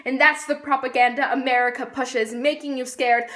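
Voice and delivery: rhythmic, declaimed slam poetry; spoken-word poem. Acted. Poet on American propaganda and fear, spoken-word recital. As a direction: recite it as a pointed political poem, building in force.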